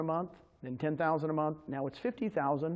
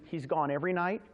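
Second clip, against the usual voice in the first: normal talking voice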